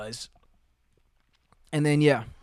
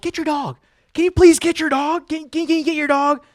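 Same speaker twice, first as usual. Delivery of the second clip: high, urgent acted plea; panicked pleading; 12 semitones higher, 6 dB louder than usual. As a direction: beg for help, stammering in mock panic. Begging a kid for help.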